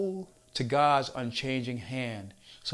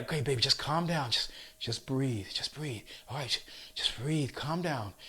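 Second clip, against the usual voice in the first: soothing coach; 6 dB quieter than usual. Retold or imitated. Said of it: "and I'm like".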